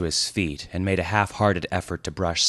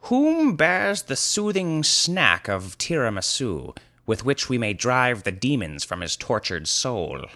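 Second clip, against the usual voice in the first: grandiose wizard; 6 semitones higher than usual. Acted. Wizard asking who carries tiramisu, grandiose. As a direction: declaim it like a solemn quest. Wizard's pompous mock-archaic plea.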